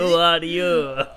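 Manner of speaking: slow mimicry through laughter; game character impression. Mimicking that guy from Valhalla, slow and laughing. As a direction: drag out the line in a put-on voice, laughing. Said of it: "it'll be that guy from Valhalla".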